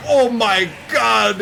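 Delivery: mock Wario anguish as a greeting; Wario wail greeting. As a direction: ham up the Wario cry as a hello. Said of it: "they're like".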